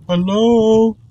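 high, held nervous hello; drawn-out, high-pitched call. Real, not acted. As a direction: stretch the last vowel up high. Calling out to unseen thing.

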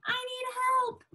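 high mock cry for help; very high, play-acted plea; pretend panic. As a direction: wail it as the frightened witch. Acting out the witch's plea.

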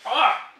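sour gasp. Real, not acted. Reacting to sour candy.

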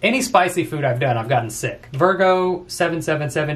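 ordinary delivery

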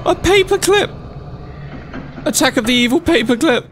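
high-pitched, half-comic screaming; shrieking alarm. Real, not acted. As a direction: shriek in baffled horror. Startled by strange creature.

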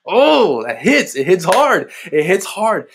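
ordinary talk, nothing marked

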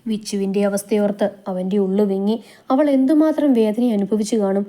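ordinary delivery

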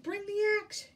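high, loud storybook command; urgent shout. A narrator's reading. Jack calls for the axe, urgent shout. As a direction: shout it urgently, pitched well up. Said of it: "he shouted".

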